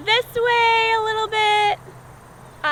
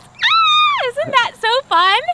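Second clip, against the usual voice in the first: excited; 8 semitones higher, 7 dB louder, slower than usual. Real, not acted. Delighted exclaim to children.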